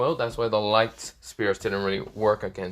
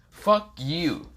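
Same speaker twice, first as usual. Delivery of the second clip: angry retort; 8 semitones higher than usual. Acted. Issei glares at Drake.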